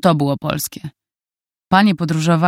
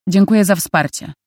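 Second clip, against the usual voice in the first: sarcastic; 3 semitones higher than usual. A narrator's reading. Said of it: "Nawet podziękowanie zabrzmiało sarkastycznie".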